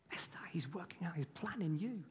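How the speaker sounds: whisper. Real, not acted. Speaking tenderly to one child.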